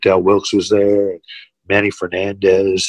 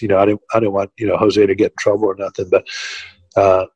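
normal talking voice

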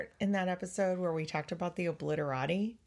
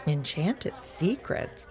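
slow, questioning musing; puzzled; slower than usual. Acted. Power Dog asks himself about the secrets, puzzled. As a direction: wonder slowly to yourself. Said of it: "puzzled Power Dog to himself".

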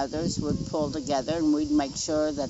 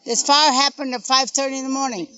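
higher and louder, indignant; heated and upset; 7 semitones higher, 5 dB louder than usual. Real, not acted. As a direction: press every point, fed up. Mother's angry recounting, raised voice.

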